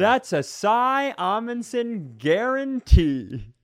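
hammed-up sales guarantee; salesman's slogan. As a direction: draw it out like an ad slogan.

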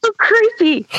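laughing. Real, not acted. Laughing about creepy clowns.

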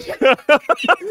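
laughing high and loud. Real, not acted. Laughing at own joke.

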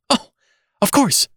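very high, flustered reply; hysterical cry. A narrator's reading. Terrified nurse to Mister Button, hysterical. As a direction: agree in a panic. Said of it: "she cried hysterically".

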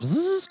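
high electronic buzz; robot buzz. Pretend. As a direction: buzz like a booting computer. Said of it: "What would that sound like?".

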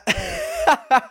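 very high burst of laughter; real squeaky laugh; high-pitched laugh. Real, not acted. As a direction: crack up in a high squeal at yourself. Cracks up at own mistake.

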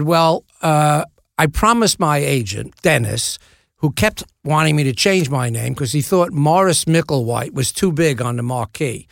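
mock-Cockney storyteller; imitated Cockney, conversational; Michael Caine. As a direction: spin an anecdote as a rough London star impression. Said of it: "This is a terrible Michael Caine impression".

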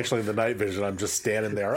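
ordinary delivery

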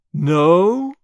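loud, drawn-out questioning no; mocking cry. A narrator's reading. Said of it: "he cried".

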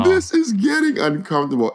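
high mocking uncomfortable voice; mock office girls. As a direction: squeak it like prissy office girls.

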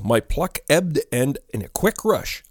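ordinary delivery

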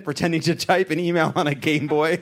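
ordinary talk, nothing marked

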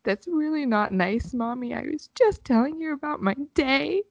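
a hurt daughter reproaching her mom; heartbroken child. Retold or imitated. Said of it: "heartbroken, devastated".

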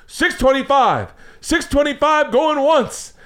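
slow, high auctioneer going once; slow, high mock auctioneer; auctioneer count. Auctioneer calling bids.

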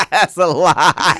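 loud, high, stretched out through laughter; laughing accusation. Real, not acted. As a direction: crack up while calling it out. Repeats the jibe while laughing.

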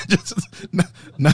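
laughing at his own joke; breaking into laughter mid-sentence; cracking up mid-line. Real, not acted. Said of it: "Sorry, I'm laughing at my own joke".

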